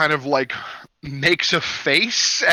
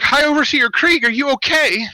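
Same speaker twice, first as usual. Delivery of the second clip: cheery greeting; 11 semitones higher, 4 dB louder than usual. Acted. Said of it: "and she's like".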